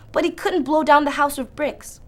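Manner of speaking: ordinary delivery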